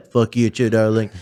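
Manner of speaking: cool comeback in an impersonator's voice; Donald Trump. Retold or imitated. Impersonator answering the cursing woman, cool Trump comeback. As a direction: toss it back in the politician's voice. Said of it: "And I was like".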